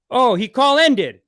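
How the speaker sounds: a real jolt of shock, stretching the words; stunned disbelief. Real, not acted. Host finds the scammer hung up, loud slow disbelief.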